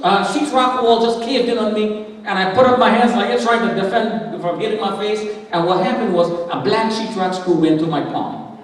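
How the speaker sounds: ordinary talk, nothing marked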